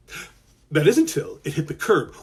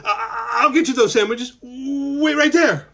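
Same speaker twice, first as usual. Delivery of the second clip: put-on nervous boy; 8 semitones higher, 4 dB louder than usual. Malcolm stammering to dragon.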